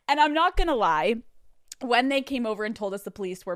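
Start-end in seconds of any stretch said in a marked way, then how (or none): none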